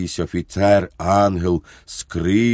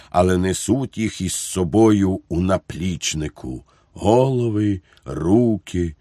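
usual speaking voice

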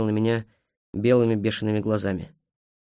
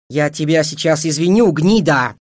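loud, raised, ringing threat; ringing fury; 4 semitones higher, 7 dB louder than usual. A narrator's reading. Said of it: "звенящим голосом сказала Эйджел". (звенящим от гнева голосом)

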